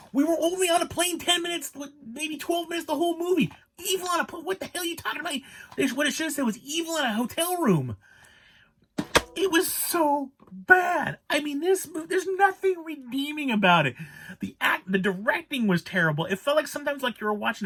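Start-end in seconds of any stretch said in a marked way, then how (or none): 0.1-8.0 exasperated rant. Real, not acted. 9.4-11.2 exasperated groan. Real, not acted. Repeated verdict on bad movie. 11.3-14.0 exasperated rant. Real, not acted.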